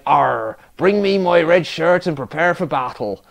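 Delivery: a light West Country pirate burr; captain again calls his men to battle, in a West Country pirate accent. Acted. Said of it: "Apparently he's from the West Country now".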